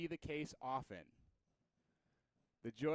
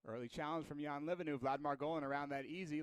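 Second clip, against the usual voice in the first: usual speaking voice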